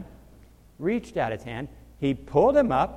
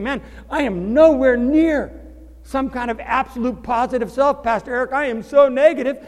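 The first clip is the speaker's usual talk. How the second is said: mock despairing believer; 8 semitones higher, 6 dB louder than usual. Believer complaining to Pastor Eric, mock-high and whiny. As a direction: moan about falling short.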